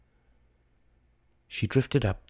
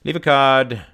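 loud, higher offhand dismissal; careless; 6 semitones higher, 8 dB louder than usual. Acted. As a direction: toss it out to be rid of her. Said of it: "said the young man carelessly".

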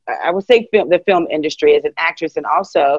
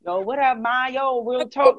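higher, softer imitated New York talk; New York slang; 6 semitones higher, 5 dB quieter than usual. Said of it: "but New York slang".

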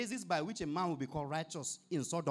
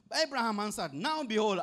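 emphatic announcement; 5 semitones higher, 6 dB louder than usual. Acted. Preacher announcing Abraham's reply.

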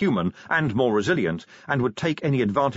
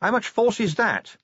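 higher, a touch of a shout; raised voice, not a full yell; mild shout; 9 semitones higher than usual. A narrator's reading. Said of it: "Vimes shouted".

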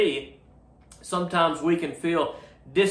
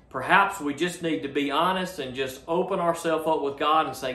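ordinary talk

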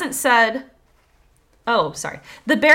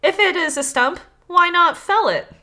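higher, mildly raised shouting voice; understated shout, pitched up; storybook shout; 5 semitones higher, 4 dB louder than usual. A narrator's reading. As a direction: read it as the fox calling out. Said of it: "The fox shouted".

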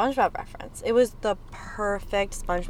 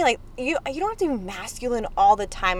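usual speaking voice

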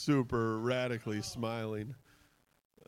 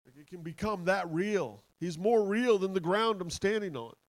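higher, louder, fervent conviction; real excitement; 7 semitones higher, 5 dB louder than usual. Real, not acted. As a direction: testify excitedly, voice lifted. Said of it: "I just get so excited".

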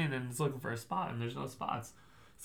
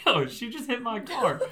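higher-pitched, indignant news-breaking; retold agitated report; 8 semitones higher, 4 dB louder than usual. Driver retelling how he told his passenger, agitated report. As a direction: blurt it out to your companion, pitched up. Retells telling Kaylee about the hit.

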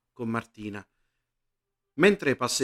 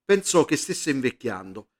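ordinary delivery

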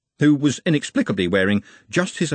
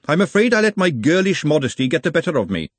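higher, quick mock-demure excuse; sweetly put-on modesty, pitched up; covert resentment; 8 semitones higher, 4 dB louder, faster than usual. A narrator's reading. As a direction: hurry out a coy apology through resentment. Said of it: "cursing him with her eyes".

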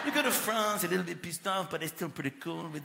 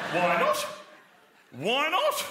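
pushy why not; 7 semitones higher, 7 dB louder, slower than usual. Retold or imitated. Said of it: "They're like".

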